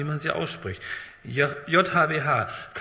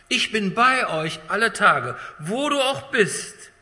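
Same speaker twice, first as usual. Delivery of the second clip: slow, louder, climbing higher; impassioned promise; 6 semitones higher, 4 dB louder, slower than usual. Acted. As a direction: lift the promise up to the congregation. Repeated promise, rising to climax.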